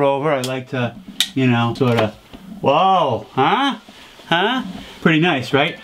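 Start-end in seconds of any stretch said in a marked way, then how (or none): none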